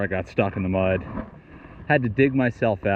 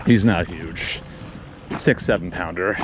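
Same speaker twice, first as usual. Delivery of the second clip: panting between words; breathless after the struggle; out of breath; 3 semitones lower than usual. Real, not acted. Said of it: "Sorry, I'm out of breath".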